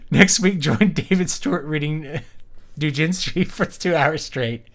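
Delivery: slow, loud mock promo voice; a jokey slow announcer read. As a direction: hype it like a radio trailer, slowly. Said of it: "mock announcer promo".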